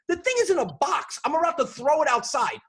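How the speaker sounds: high, loud threatening rant; irate outburst. Retold or imitated. Customer threatening to throw the computer out, loud fed-up rant. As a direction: yell it, at the end of patience. Acted quarrel with support agent.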